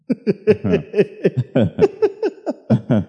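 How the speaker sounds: high, long laugh; drawn-out run of high laughter. Real, not acted. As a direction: break into a long, high chuckle. Laughs after his bold questions.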